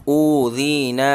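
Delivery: ऊ ज़ी ना slow, each long vowel held equally; slow drawn-out recitation; all vowels stretched. Shown as a demonstration. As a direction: hold ऊ, ज़ी and ना the same length. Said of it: "तीनों ही बराबर खींचेंगे".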